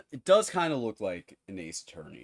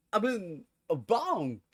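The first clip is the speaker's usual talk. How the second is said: dumb voice; 10 semitones higher, 5 dB louder, much slower than usual. Acted. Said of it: "It's a dumb voice".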